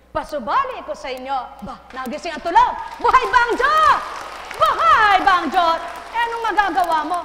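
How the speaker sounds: shouting, high and loud; demonstrating the loud voice; loud preaching. Shown as a demonstration. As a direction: boom it out to wake them. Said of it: "Pag lumalakas ang boses".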